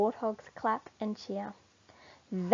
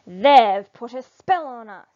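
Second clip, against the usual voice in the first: loud, angry group of animals; 2 semitones higher, 12 dB louder than usual. Acted. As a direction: spit it out in a furious crowd voice. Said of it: "stamp off angrily".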